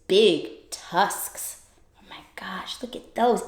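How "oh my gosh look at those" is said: hushed whisper; awed whisper. Acted. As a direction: whisper in wonder at the tusks. Awed at tusks.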